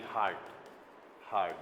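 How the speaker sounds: ordinary talk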